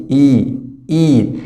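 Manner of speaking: إيد said slowly with long ee; teacher models إيد; long ee demo. Shown as a demonstration. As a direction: stretch the ee in إيد. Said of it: "الإي آي مد بالإي".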